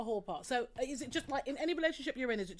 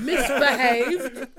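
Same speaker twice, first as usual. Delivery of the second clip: slow, louder, drawn-out posh "Misbehaved?"; terribly posh; 2 semitones higher, 14 dB louder, much slower than usual. Pretend. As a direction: stretch "Misbehaved?" like a toff, laughing. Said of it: "That sounded terribly posh".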